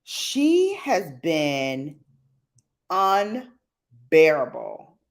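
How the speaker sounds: very slow, weighted complaint; drawn out. Real, not acted. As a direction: stretch every word out. Complaining about spoiled dog.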